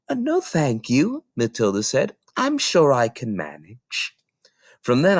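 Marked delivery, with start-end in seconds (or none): 0.1-1.2 put-on high, slow child's voice; little girl voice. Little girl politely refusing the librarian's help, high and slow. 2.4-4.1 put-on little girl voice. Matilda declines help.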